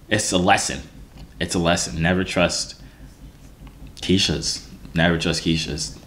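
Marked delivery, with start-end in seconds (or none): none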